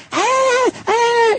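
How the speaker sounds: squealing falsetto ah, ah; mock high girly chatter. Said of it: "they're sitting there talking like".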